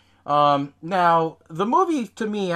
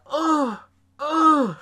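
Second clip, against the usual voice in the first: high-pitched mock heaves; comic puking groans; imitated retching; 12 semitones higher, 5 dB louder than usual. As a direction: mimic coughing up sick in short bursts. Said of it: "he's still coughing up".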